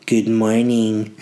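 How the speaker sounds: slow, stretched good morning; drawn-out greeting. Shown as a demonstration. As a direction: drag out good morning slowly. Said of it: "More, good morning".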